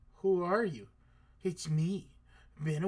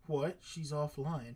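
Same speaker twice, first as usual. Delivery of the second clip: ordinary delivery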